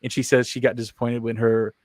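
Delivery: ordinary talk, nothing marked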